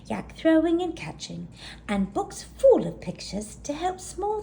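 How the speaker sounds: put-on high, bright young yak voice; a high, eager little sister voice. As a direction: list her treasures eagerly. Gertie's eager voice.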